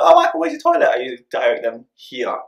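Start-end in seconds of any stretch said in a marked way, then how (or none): 0.0-0.9 higher, louder voice of a visitor asking; imitated guest. Guest asking Michael the way, imitated in a higher voice. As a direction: lightly act out a guest's question. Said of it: "usually when they ask me".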